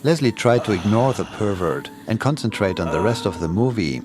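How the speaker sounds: plain reading voice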